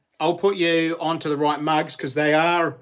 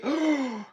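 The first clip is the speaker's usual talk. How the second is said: surprised gasp; 10 semitones higher, 4 dB quieter than usual. Real, not acted. Spots an old friend in chat.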